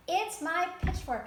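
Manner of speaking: high, loud, slow insistence; insistent farmer. A narrator's reading. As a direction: plant your feet and insist.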